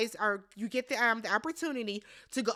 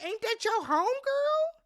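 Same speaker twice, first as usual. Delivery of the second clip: high, sassy question; mock incredulous; 11 semitones higher than usual. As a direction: raise your voice in sassy disbelief. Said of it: "And I'm like".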